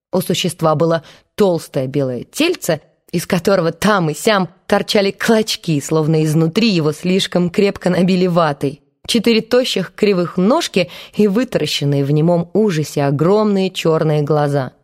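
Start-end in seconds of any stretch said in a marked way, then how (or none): none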